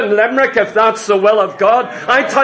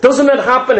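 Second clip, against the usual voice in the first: ordinary talk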